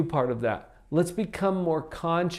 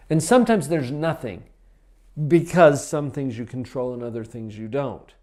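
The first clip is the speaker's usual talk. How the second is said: ordinary delivery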